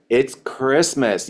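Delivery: said slowly, stretching Christmas; slow repeated pitch. Retold or imitated. Seller answering a customer's money excuse, slow and insistent. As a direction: slowly press it back at them. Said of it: "repeat back to them".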